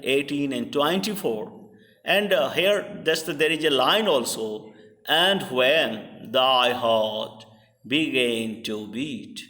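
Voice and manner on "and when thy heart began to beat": slow, weak-strong: and WHEN thy HEART be-GAN to BEAT; iambic reading. Shown as a demonstration. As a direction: scan the line slowly so each second syllable lands. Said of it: "Here and is unstressed, when is stressed".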